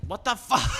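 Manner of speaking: loud high outburst; mobster shout. Retold or imitated. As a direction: yell it in the mobster voice. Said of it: "mobster voice".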